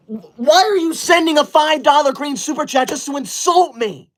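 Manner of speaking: high-pitched, outraged scolding; indignant outburst. Acted. Streamer to insulting donor, indignant outburst. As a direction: protest like you've been deeply insulted.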